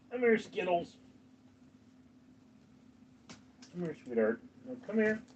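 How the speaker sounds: coaxing the cat. Real, not acted. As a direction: coo gently to lure the cat over.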